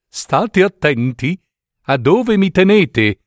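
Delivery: lightly voiced fussy needle warning. A narrator's reading. Needle warns the fingers holding it, lightly fussy. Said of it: "disse l'ago da rammendo alle dita".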